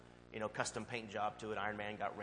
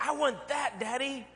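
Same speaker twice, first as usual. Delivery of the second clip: put-on high child's voice begging; whiny little boy; 14 semitones higher, 6 dB louder, slower than usual. Son begging dad for the dart gun, high and whiny. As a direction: plead like a kid spotting a toy. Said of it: "He's like".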